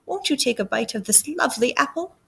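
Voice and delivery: put-on soft old-lady coaxing; lightly voiced old woman; disguised old lady. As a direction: offer it sweetly in a small, quiet voice. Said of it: "disguised herself as a little old lady".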